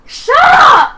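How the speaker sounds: sudden loud high yell; shouting. Retold or imitated. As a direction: bark it out at full volume. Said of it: "It was like".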